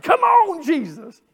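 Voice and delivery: high loud cry of Come on; shouted amen-corner call; imitated church shout. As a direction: mimic a lady hollering from the pew. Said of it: "She'd get to shout".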